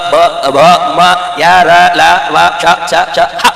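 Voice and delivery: even monotone chant of single letters, with small gaps; each letter placed separately and evenly, as an articulation drill; letter-by-letter demonstration. Shown as a demonstration. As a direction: recite the Devanagari alphabet one isolated sound at a time. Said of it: "अब देखो इक्यावन अक्षर कैसे उत्पन्न होते हैं".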